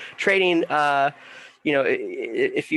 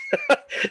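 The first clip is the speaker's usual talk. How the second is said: quick amused laugh; a real little laugh; short laugh. Real, not acted. Laughs at people without savings.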